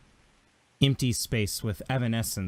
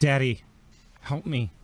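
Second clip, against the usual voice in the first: higher-pitched plea to a father; anguished child; 3 semitones higher, 4 dB louder than usual. Acted. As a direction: plead for help with a touch of anguish. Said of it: "a tremendous amount of anguish".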